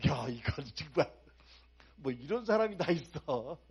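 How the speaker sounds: indignant disbelief. Retold or imitated. Preacher marvelling at Martha's nerve, loud mock indignation. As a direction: play it up, amazed and indignant.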